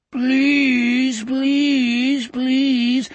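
high, drawn-out creature chant; hoarse, high monster voices; hoarse inhuman chorus. Acted. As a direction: chant it like mocking beasts. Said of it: "A hoarse, inhuman chorus of voices".

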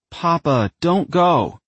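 high-pitched, mildly mournful plea. A narrator's reading. Daughter begging her fading ghost father to stay, mildly mournful. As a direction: plead upward with a touch of grief. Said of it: "uttered in a mournful tone".

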